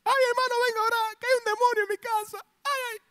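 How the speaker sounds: hammed-up wail of a scared churchgoer; mock whining wail. Frightened believer begging the pastor for prayer, mock wailing. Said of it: "Usted es lo que sale llorando".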